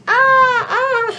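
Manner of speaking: high, loud "aah" cries; comic wail. Acted. Everybody fallen in a heap, high comic cries. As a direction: wail high as if toppling over. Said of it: "everybody was, was like".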